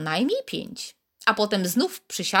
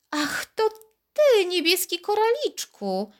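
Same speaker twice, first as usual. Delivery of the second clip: amazed whisper; 11 semitones higher than usual. A narrator's reading. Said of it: "szepnęła ze zdumieniem".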